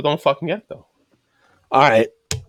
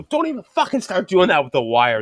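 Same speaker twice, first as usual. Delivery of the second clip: exasperated warning in a higher pitch; retold scolding; 7 semitones higher than usual. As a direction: cut a rookie off, voice pitched up. Retold scolding of a rookie worker.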